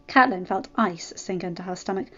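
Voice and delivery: usual speaking voice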